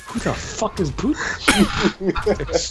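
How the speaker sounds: high-pitched, incredulous question; retold bafflement. Teller recalls hearing the boast, baffled and high. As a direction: replay your disbelief at an unknown name. Retelling his reaction to the boast.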